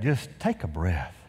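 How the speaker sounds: slow, soft invitation to breathe; gentle breathing cue; calm guided breath. Shown as a demonstration. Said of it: "Everybody do that".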